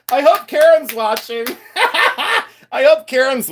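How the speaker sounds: high-pitched, giggly speech; tickled by your own cheeky joke; laughing. Real, not acted. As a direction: talk through a fit of laughter. Cracks up after daddy joke.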